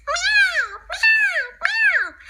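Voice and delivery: high, crying meows; squeaky cat meows; high meowing cat. Acted. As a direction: mew like a little cat. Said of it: "the puppy responded".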